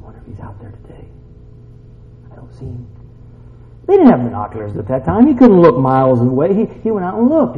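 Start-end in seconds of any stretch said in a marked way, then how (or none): 0.0-2.9 very soft, nearly whispered musing; father quietly searching, barely audible; hushed self-talk. Acted. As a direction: wonder under your breath. Father peering out, to himself.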